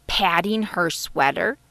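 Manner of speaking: plain reading voice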